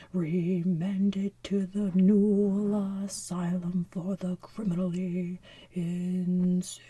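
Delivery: slow, loud, drawn-out verdict; solemn judge. Acted. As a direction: pronounce the sentence slowly and loud. Judge's sentence in the musical.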